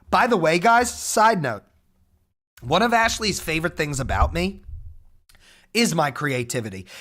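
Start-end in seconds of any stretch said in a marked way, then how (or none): none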